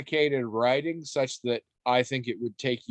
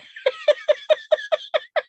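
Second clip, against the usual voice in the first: shrieking laugh; high burst of laughter; 4 dB louder than usual. Real, not acted. Laughing at own joke.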